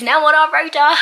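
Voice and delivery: thrilled news; excited. Real, not acted. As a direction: announce it happily. Excited about new hire.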